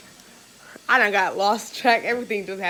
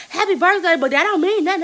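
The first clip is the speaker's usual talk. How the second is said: loud, much higher mock yell; mock yelling driver; 9 semitones higher, 8 dB louder than usual. Annoying bus driver yelling happy birthday, loud mock yell. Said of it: "she yelling at you talking about".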